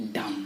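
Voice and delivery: "dumb" as dum, no B; silent B. Shown as a demonstration. Teacher after spelling it out, silent B. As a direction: say "dumb" ending on M. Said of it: "pronounced as".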